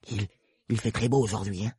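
lightly voiced timid rabbit; timid murmur. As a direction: venture it shyly, barely changed voice. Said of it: "murmura une voix timide".

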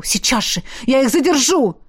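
urgent, curt insistence; abrupt order. A narrator's reading. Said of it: "отрывисто бросил тот". (отрывисто и настойчиво)

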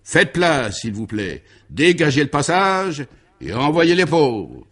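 loud, high call into the mic; shouted call. A narrator's reading. Baron shouting into the microphone for room. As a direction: clear the way, loudly.

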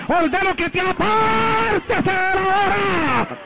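high, drawn-out exorcist's command; re-enacted deliverance prayer at full preaching pitch; fiery rebuking prayer. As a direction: command the devil out, stretching the final words.

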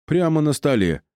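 put-on high, tittering old lecher; old wizard snickering at the thought; giggling old man. A narrator's reading. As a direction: relish it like a randy old man. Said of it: "хихикнул старичок".

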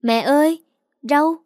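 put-on a little boy crying out excitedly; small child calling out. A narrator's reading. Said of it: "kêu lên".

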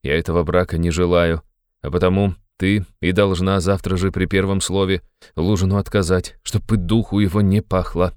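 ordinary delivery

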